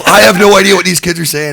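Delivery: high-pitched, mock-flustered protest; retold panic. Storyteller surrounded by kids at gas station, mock panic. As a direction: protest it in rising comic panic. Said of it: "And I started to panic".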